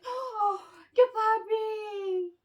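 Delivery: put-on sweet drawn-out baby talk; cooing at puppy. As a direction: melt over a puppy picture. Cooing at dogs on Facebook.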